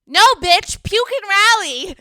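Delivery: high, loud, drawn-out mock order; mock bossy drunk friend. Drunk friend mocking sick Tay, high and loud. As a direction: imitate the drunk friend brushing her off. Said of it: "the saying of our friendship".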